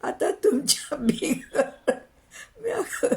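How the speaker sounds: high, drawn-out sobbing wail; keen slowly, breaking into sobs on the words; loud wailing lament. Acted. Wife mourning the husband who left her alone, wailing. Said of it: "गंगीनं जोरानं गळा काढला".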